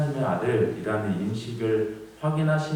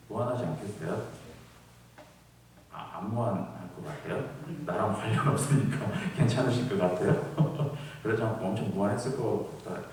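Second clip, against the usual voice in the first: normal talking voice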